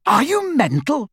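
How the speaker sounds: high-pitched disbelieving question; incredulous. A narrator's reading. Friend questioning the refusal of the wand, incredulous. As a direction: shoot the pitch right up in disbelief.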